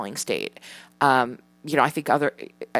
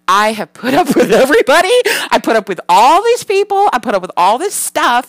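put-on loud, high-pitched ranting kid voice; imitated son's meltdown; 6 semitones higher, 7 dB louder than usual. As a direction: mimic a five-year-old losing it after school. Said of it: "he just loses his shit".

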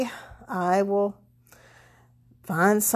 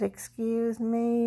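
slow, soft, pointed excuse me; lowered voice; 3 semitones higher, 9 dB quieter, slower than usual. Retold or imitated. To the rude pharmacy clerk, quiet and slow. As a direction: drop the voice low and slow to make a point. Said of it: "I lowered my voice really, you know, low".

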